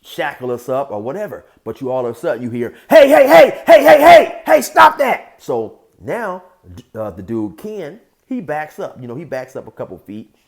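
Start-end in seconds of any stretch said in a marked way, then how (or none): 2.9-5.4 very loud, high urgent holler; booming acted command to stop; guard shouting. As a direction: break up a fight from across the room. Guard breaking up the fight.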